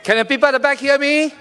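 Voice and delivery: fiery rally address; rally speech. Real, not acted. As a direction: pump up the crowd, building to a roar. Candidate opens rally speech.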